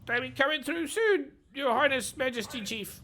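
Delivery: very high, squeaky flattery; grovelling goblin. Acted. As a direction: fawn over the orc in a squeak.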